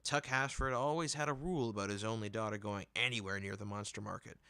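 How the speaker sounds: usual speaking voice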